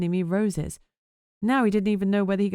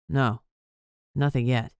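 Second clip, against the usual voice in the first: a concerned boss, pitched lower; lightly voiced man, deeper; lower male voice; 5 semitones lower than usual. Whip answers.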